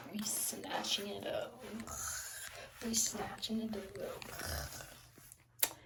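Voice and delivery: slow whispered hype, slowing further. Real, not acted. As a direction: hush it and stretch it out.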